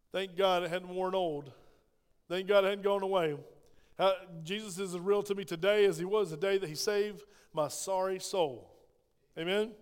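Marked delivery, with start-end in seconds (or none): none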